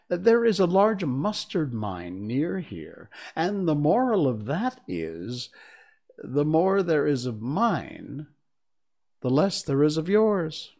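put-on preachy old duchess; frail withering duchess. Duchess giving a moral.

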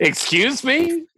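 high, slow outraged disbelief; stunned, stretch out me; shocked. Acted. Shocked reaction to open marriage.

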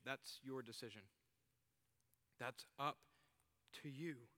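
soft and tender, letting them go; gentle, giving space. Acted. Lover gently giving space to the one who refuses, softly. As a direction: speak softly, kindly backing off.

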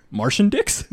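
high surprised rising question; puzzled question. Retold or imitated. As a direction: ask it, baffled and high. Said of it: "he's like".

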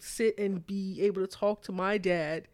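plain reading voice

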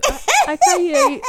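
high laughing I can't hear you; laughing teasing. Pretend. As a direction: dodge the question while laughing.

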